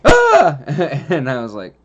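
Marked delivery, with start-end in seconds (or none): 0.0-0.6 sudden shrieking scream, much higher and louder; a full-blown jump-scare shriek; reliving the scare with a piercing ah; startled scream. Retold or imitated. Startled by snake on lap.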